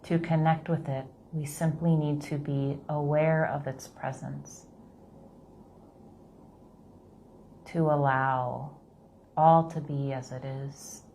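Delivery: slow, soft meditation voice; guided meditation. Acted. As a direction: gently guide them through the breath.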